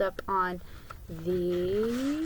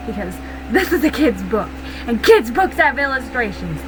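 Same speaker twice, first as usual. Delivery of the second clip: louder, mock-insistent explanation; playful emphatic; 3 semitones higher, 7 dB louder, faster than usual. Real, not acted. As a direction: hammer it home jokingly. Joking emphasis about kids' books.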